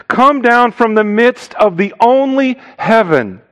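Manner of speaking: "only" pitched high and stretched; stress on only. Shown as a demonstration. Stressing only in literal translation.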